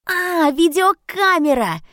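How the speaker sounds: high, slow, loud realisation; light up with sudden realisation; excited exclamation. Acted. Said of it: "воскликнул Немо".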